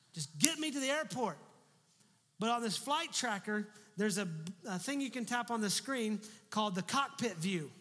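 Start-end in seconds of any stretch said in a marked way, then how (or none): none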